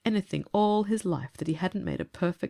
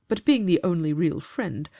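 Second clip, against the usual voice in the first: usual speaking voice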